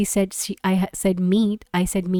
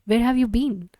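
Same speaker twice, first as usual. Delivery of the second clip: normal talking voice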